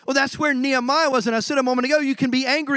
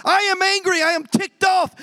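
righteous anger; 7 semitones higher than usual. Pretend. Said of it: "rise up and take a stand and say".

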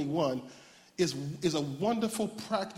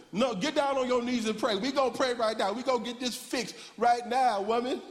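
high, bossy self-righteous command; pompous; 6 semitones higher than usual. Retold or imitated. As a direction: order your wife to pray, playing it pompous. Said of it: "with my little pompous behind".

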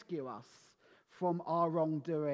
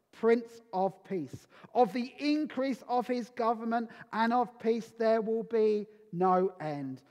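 louder, proclaiming Bible reading; scripture reading; 4 dB louder than usual. Acted. Preacher reading Isaiah to the congregation, proclaiming. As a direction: declaim the prophecy to the church. Reads Isaiah nine aloud.

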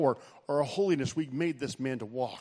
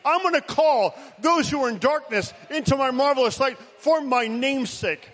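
loud, high preaching at full force; rally speech; 7 semitones higher, 7 dB louder than usual. Acted. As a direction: proclaim it like a rally cry.

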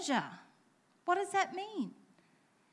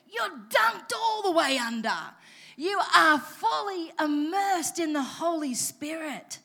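shouted, louder preaching burst; excited; 3 semitones higher, 7 dB louder than usual. Real, not acted. Preacher carried away describing full immersion, shouting. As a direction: let the excitement boil over into a shout. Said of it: "I'm feeling a bit excited".

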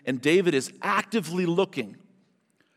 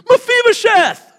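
put-on a loud, high, delighted shout of a name, like greeting an old buddy; David calling Mephibosheth in glad welcome, a loud joyful shout; 15 dB louder, slower than usual. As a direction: call out the name like a long-lost friend. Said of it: "As if they'd been buddies".

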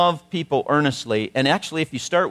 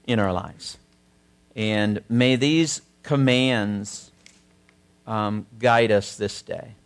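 closing prayer; 3 semitones lower than usual. Acted. Pastor leading the congregation in closing prayer. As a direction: pray reverently, slowing toward the end.

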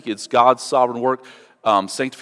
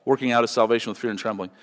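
ordinary talk

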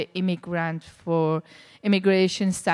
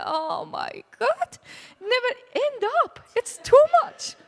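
exasperated protest. Retold or imitated. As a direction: throw up your hands at more knitting. Asked to knit again.

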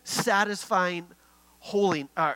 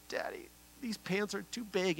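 mock whine, higher and softer; imitating tearful child; 3 semitones higher, 9 dB quieter than usual. Young daughter upset about her pants, imitated crying. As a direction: softly mimic a child's tearful complaint. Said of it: "she just starts crying".

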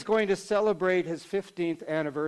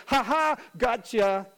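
higher, teasing "ha ha, gotcha"; gloating mock laugh; 5 semitones higher, 4 dB louder than usual. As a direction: crow it like a prankster. Imagined pranksters' signs read aloud.

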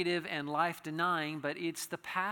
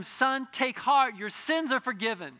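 loud, slow and higher proclamation of Jesus's words; proclaimed blessing; 8 semitones higher, 10 dB louder than usual. Acted. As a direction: ring out each word like a pronouncement.

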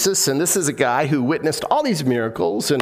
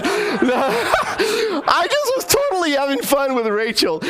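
high, laughing protest; helpless laughter while explaining yourself; 12 semitones higher, slower than usual. Real, not acted. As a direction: laugh through the words, drawn out. Laughing over his joke.

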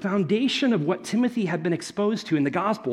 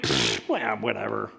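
dismissive; 8 semitones lower, 3 dB quieter than usual. Retold or imitated. Teen dismissing parents.

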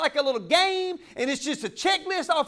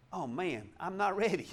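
much softer, lower deflated mock voice; caught unprepared; 8 semitones lower, 10 dB quieter than usual. Preacher mocks himself caught unready, soft and deflated. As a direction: sound caught off guard and let down. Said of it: "He ain't coming to Nathan's house and going".

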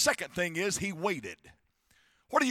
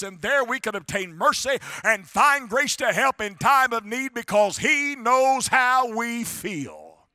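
raised, driving preacher cadence; preaching; 4 semitones higher, 5 dB louder than usual. Real, not acted. Preacher telling the congregation God knows their feelings, preaching. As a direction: build it like a preacher, stretching the last words.

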